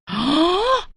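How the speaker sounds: sharp, high gasp at the bill; shocked gasp. Acted. (बिल देखकर हैरानी की साँस)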